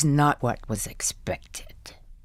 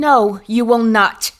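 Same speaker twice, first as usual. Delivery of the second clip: louder, firm and final; firm refusal; 4 semitones higher, 6 dB louder than usual. Acted. Wife refusing the staff's offer, firm and loud. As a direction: shut the offer down hard.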